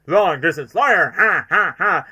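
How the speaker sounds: slow loud taunt with a mocking laugh; Jim Carrey mannerisms. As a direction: drag it out, then cackle ha ha ha. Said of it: "bringing Carrie mannerisms where he goes".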